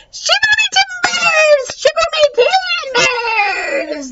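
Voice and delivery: very high parrot screech. A narrator's reading. Pet shop parrot screeches pirate talk, very high. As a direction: shriek it like a squawking bird. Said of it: "the voice screeched".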